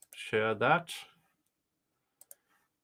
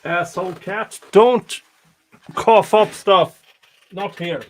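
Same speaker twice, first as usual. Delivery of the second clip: loud, scolding the cat; yelling at cat; 6 semitones higher, 11 dB louder than usual. Real, not acted. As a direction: snap at the pet to stop.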